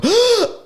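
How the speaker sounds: big sucked-in gasp for air; sharp gasp. Shown as a demonstration. Showing how he breathes while calling, sharp gasp. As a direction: gulp a breath between calls. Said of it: "when I go".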